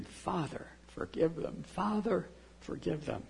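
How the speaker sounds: soft, hushed prayer; almost under the breath, gently; quiet plea. Retold or imitated. Jesus praying softly for those abusing him, hushed.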